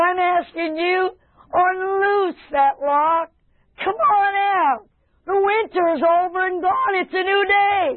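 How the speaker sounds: high, stretched preacher's summons; jubilant calling out. Acted. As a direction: call them out with rising joy. Acts out God calling the beloved out.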